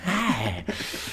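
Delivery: burst of laughter. Real, not acted. Laughing at the silly line.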